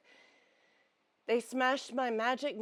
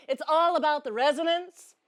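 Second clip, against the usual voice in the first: higher, louder voice-training demonstration; resonant feminine voice; 6 semitones higher, 8 dB louder than usual. Shown as a demonstration. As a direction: lift the voice high and let it ring. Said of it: "and my voice, ooh, practice".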